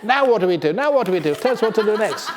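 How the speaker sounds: high, loud impatient monkey pestering. Acted. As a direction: pester the teacher like restless monkeys. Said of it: "impatient monkeys asking".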